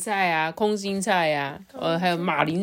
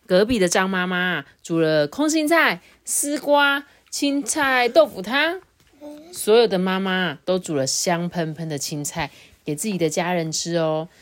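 put-on children's storytelling; 2 semitones higher, 3 dB louder than usual. Adult tells a child of mothers cooking greens, storytelling. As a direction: build up the tale for a small child.

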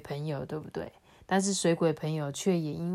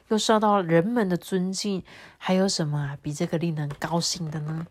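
put-on reading to children; 2 semitones higher, 5 dB louder than usual. A narrator's reading. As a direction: tell it warmly to the kids. 给孩子讲故事的叙述. (给孩子讲故事的语气)

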